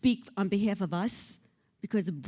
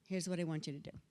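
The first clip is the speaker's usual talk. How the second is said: ordinary delivery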